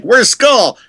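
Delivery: exaggerated eager asking; imitated fan question. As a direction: shout it like a curious fan. Quotes people asking after his partner.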